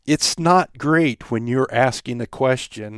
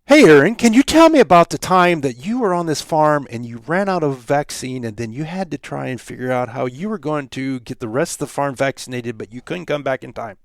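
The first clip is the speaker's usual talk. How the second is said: bad pacing; 2 semitones higher than usual. Shown as a demonstration. Host rambles a question to a podcast guest, running out of breath and fading. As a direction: rush it all on one breath until the voice dies away. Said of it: "here's an example of bad pacing".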